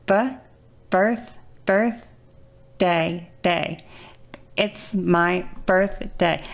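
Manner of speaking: "It's my birthday" built syllable by syllable, very slowly; slow, effortful. Shown as a demonstration. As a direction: force out "birthday" one piece at a time. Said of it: "such a fucking challenge".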